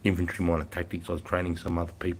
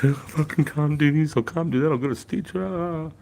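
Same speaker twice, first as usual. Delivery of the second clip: whiny recruit; 9 semitones higher, 7 dB louder than usual. Retold or imitated. Exhausted recruit complaining to his corporal, mock whiny. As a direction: whimper it like a broken recruit. Recruit collapsing, whining on hill.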